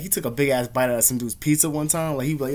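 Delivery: ordinary talk, nothing marked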